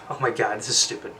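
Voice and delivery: normal talking voice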